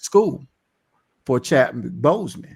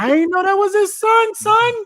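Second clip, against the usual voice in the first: much higher, squeaky joking voice; mock squeak. Real, not acted. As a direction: squeal it like a cartoon. Laughing, squeaky, high in disbelief.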